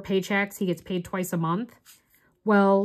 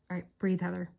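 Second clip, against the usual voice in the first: calming herself; 9 dB quieter, faster than usual. Real, not acted. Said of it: "I'm hyperventilating".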